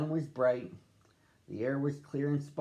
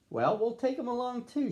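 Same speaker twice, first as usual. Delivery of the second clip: high, hearty reassurance; big-hearted papa voice; jolly Papa Bear; 13 semitones higher than usual. Acted. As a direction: chuckle it off for the cub.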